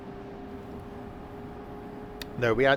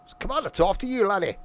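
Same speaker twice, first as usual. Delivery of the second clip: lightly put-on Scottish accent, high; 7 semitones higher than usual. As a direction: growl it like a Scot, high. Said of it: "He's obviously Scottish".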